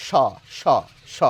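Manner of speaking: teacher demonstrates the palatal স sound. Shown as a demonstration. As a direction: hush স like sh. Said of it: "সেই স হচ্ছে এই তালব্য স".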